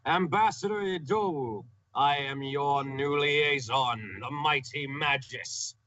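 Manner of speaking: slow, high-pitched grand announcement; booming voice. Acted. Mighty mage introduces himself to the ambassador, booming and slow. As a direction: proclaim it in a big, drawn-out mage's voice. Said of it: "I say in my most booming voice".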